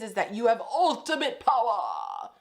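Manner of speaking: mustache twirling villain; sinister villain gloating over power; evil villain voice. Acted. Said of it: "uh, evil villain voice".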